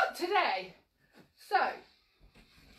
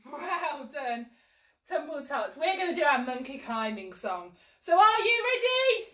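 usual speaking voice

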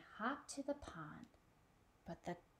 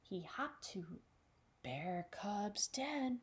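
plain reading voice

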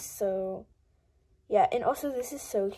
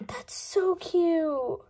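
ordinary talk, nothing marked

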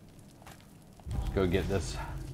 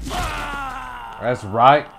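high shouted triumph; victory shout; 4 dB louder than usual. Real, not acted. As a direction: scream it in triumph. Victory shout after kill.

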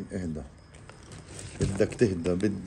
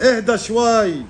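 high, loud, drawn-out cry to settle down; real high-pitched yell; alarmed shout; 14 semitones higher, 9 dB louder, slower than usual. Real, not acted. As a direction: shout in alarm at a thrashing animal. Startled, telling lizard to calm.

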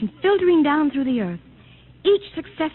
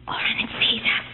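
whispered, terrified; frightened whisper; 4 dB quieter than usual. Acted. Wife seeing the robot's light find them, frightened whisper. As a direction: breathe it out in terror.

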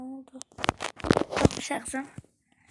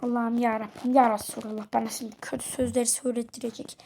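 ordinary delivery

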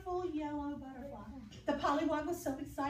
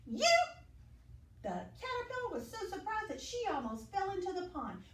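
usual speaking voice

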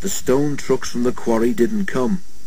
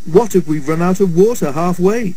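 worried, higher-pitched question; anxious; 7 semitones higher, 3 dB louder than usual. A narrator's reading. Toby asks his driver about water, anxious. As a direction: fret aloud, voice lifted with worry. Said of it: "Toby wondered anxiously".

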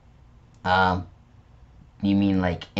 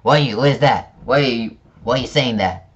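ordinary delivery

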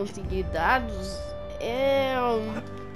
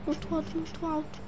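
scared; 7 semitones higher, 6 dB quieter, faster than usual. Acted. Medo da altura.

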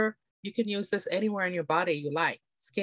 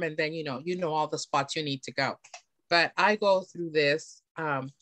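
normal talking voice

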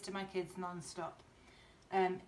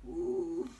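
high drawn-out mock groan; hammed-up weary ooh; 10 semitones higher, 4 dB louder than usual. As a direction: groan like a worn-out mum. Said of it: "Missus Large groaned".

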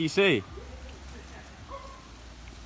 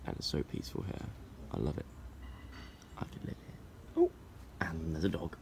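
whispering; 6 semitones lower, 17 dB quieter than usual. Real, not acted. Hushed by the quiet water.

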